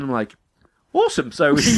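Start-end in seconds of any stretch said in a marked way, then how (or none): 0.9-1.3 high awesome. Retold or imitated. Reply in retold chat.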